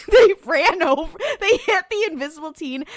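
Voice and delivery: cracking up. Real, not acted. Recapping the invisible teen hit by car, cracking up. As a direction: tell it through real laughter. Cracking up telling absurd scene.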